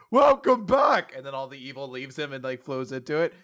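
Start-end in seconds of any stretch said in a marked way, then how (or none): 0.1-1.1 high, loud, drawn-out welcome; hammy villain greeting; Ronnie welcoming the devil. Retold or imitated. Host voicing Ronnie talking to himself, theatrical villain. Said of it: "he talks to himself because he's like".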